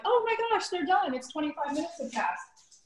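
a playful send-up of a cooking show host; Food Network host. Pretend. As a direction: ham it up like a peppy TV chef announcing the timer. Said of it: "this is gonna be like the Food Network".

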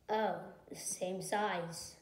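ordinary talk, nothing marked

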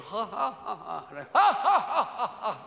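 hammed-up theatrical ha-ha laugh; actorish laugh. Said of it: "Very sort of actorish".

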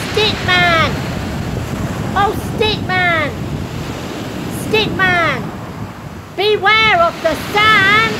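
loud, high, slow calls; loud, urgent, drawn-out warning; shouted warning. Acted. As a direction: shout out across the beach.

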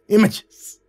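high, loud gasped word; gasping. Acted. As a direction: gasp the word out. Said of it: "he gasped".

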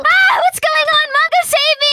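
high-pitched cry for rescue; panicked. Acted. Tina attacked by the fangirl, panicked. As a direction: call out in alarm.

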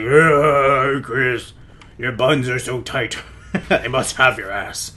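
low, slow zombie groan; lecherous mock zombie. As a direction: moan the words slowly like a zombie. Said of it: "There's probably, like, some zombie".

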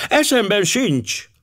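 very high, slow, cutting refusal; sharp, decided. A narrator's reading. Wife refusing her husband through the door, sharp and decided. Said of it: "A hang éles volt és határozott".

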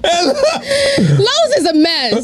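slow and laughing. Real, not acted. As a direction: let the laugh pull the words out. Laughing through a joke.

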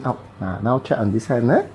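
ordinary talk, nothing marked

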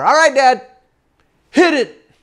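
high call out to the boat; acted-out high-pitched holler. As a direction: holler across the water. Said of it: "I look at my dad and I holler".

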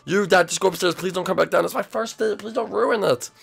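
high-pitched frantic begging; pleading. Acted. Son begging family not to ruin his date, high and pleading. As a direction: beg them urgently, voice pushed up. Son begs everyone to cooperate.

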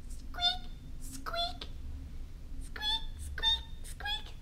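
very high, tiny squeaks; high-pitched mousy peeps; mouse squeak. Acted. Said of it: "Our mouse says".

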